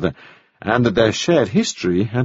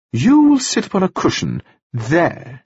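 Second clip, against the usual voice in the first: higher, eager society widow; chattering widow, pleased with herself; gushing widow; 6 semitones higher than usual. A narrator's reading. Mrs Wells directs.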